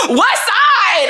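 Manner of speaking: high, drawn-out incredulous outburst; perplexed. Retold or imitated. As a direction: exclaim it in baffled disbelief. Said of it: "I was really like, perplexed".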